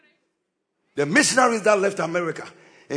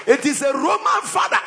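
preaching at full force; 6 semitones higher than usual. Acted. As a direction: preach it out to the whole church. Preacher rouses the congregation.

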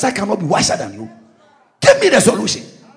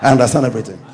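pretend retort; 10 semitones lower, 6 dB quieter, faster than usual. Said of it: "Next time they say".

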